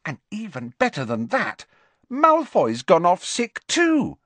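high-pitched, gleeful news-breaking; excited. Acted. Ginny tells the team rivals are out, excited.

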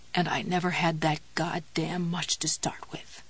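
soft, hoarse defeated whisper; bitter whisper. Acted. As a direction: breathe it out, beaten.

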